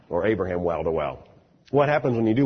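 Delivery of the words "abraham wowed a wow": low-pitched "wowed a wow", W replacing each V; German V as W. Retold or imitated. Teller repeating the line on Abraham, "wowed a wow" with W for V. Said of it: "she meant vowed a vow".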